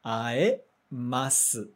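aemasu drawn out with a clear a-e; very slow model word. Shown as a demonstration. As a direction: stretch aemasu out for learners. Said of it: "Então, fica ae".

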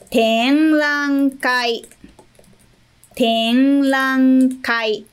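Tenrankai drawn out syllable by syllable, very slow; very slow model word. Shown as a demonstration. Teacher modelling Tenrankai for learners, very slow and clear. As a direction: spell Tenrankai out slowly for the class. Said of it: "Próximo".

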